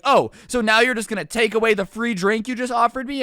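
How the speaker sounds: high, loud outraged customer; imitated customer outrage; mock indignant yelling. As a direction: act out an affronted customer's accusation. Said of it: "She starts yelling at me".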